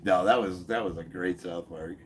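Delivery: ordinary talk, nothing marked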